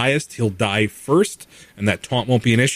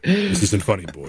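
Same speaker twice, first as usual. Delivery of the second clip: stern warrior father voice; Kratos voice. Pretend. Kratos impression.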